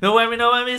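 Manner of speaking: ordinary talk, nothing marked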